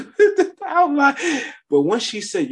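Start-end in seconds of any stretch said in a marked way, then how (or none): 0.0-1.2 high, slow, laughter breaking through; laughing. Real, not acted. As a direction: laugh out the words.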